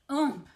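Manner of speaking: loud landing grunt oomp; a winded oomp as he hits the ground. Acted. Wilbur lands with a thump, loud grunt. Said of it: "he grunted".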